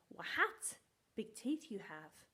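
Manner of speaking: Wa-hat with a sounded h; Wa-hat said in two beats, then big teeth you have; silly misreading. Shown as a demonstration. Teacher playing the silly wrong reading. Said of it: "That sounds funny".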